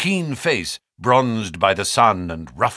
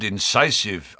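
ordinary delivery

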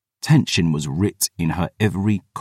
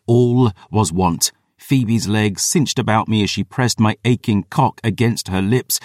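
ordinary delivery, nothing marked

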